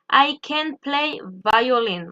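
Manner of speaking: "I can play violin" slowed, clear words; slow model sentence. Shown as a demonstration. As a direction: read the example slowly for learners. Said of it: "example sentence modelled".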